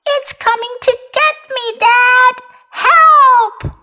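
high, wailing mock cry for help; acted child's yell. A narrator's reading. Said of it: "he yelled".

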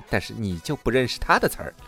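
ordinary talk

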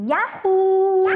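high, loud whoop of joy. A narrator's reading. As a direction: shout it as you leap. Jumping down from the tree.

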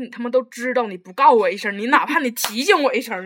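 loud, indignant retold complaint; retold exasperated outburst. Retelling the teacher not warning them, loud exasperation. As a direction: re-enact losing it, hammering out the reproach. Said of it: "当时我疯了".

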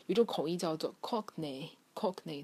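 normal talking voice

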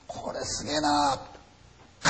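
very slow, soft, drawn-out exclamation; mock awed crew member. Storyteller voicing crew member staring at the photo, hushed slow awe.

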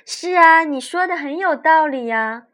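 high, loud sweet lake goddess; sweet, gentle goddess voice. A narrator's reading. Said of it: "温柔甜美的声音".

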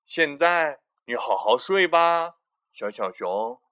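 loud, slow bedtime bear; Big Bear voice. Acted. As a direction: tuck the cub in firmly. Big Bear tucks in cub.

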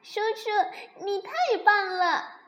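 put-on high, delighted child cry; happy shout. A narrator's reading. Baby dinosaur praising uncle for the fruit, happy shout. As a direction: cheer as a thrilled little dinosaur. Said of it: "高兴地喊道".

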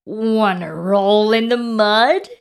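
loud storybook pig voice; pig character. A narrator's reading. Pig invites spider to mud, loud character voice. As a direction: ask it loudly as an inviting pig. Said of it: "grunted the pig".

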